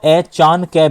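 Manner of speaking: "ए, चान, कै" slowly in three chunks; wrong syllable grouping. Shown as a demonstration. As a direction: separate "चान" from "कै" with a pause. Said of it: "भी नहीं बोल सकते". ("ए, चान, कै" को अलग-अलग टुकड़ों में बोलें)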